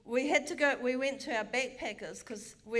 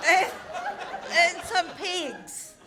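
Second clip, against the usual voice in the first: high laughing punchline, slowed; 6 semitones higher, 5 dB louder, much slower than usual. Real, not acted. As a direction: spit out the last item through laughter. Laughing, the absurd item list.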